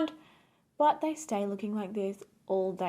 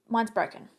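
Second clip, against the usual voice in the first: ordinary talk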